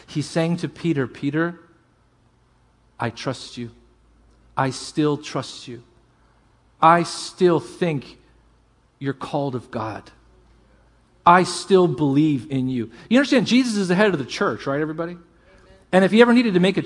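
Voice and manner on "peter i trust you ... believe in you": soft, slow, with long pauses; tender reassurance. Retold or imitated. Jesus tenderly telling Peter he still trusts him. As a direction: reassure him gently, one phrase at a time.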